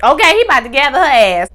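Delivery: ordinary delivery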